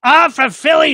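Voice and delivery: high-pitched mock threat; screaming woman. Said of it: "she's screaming at me".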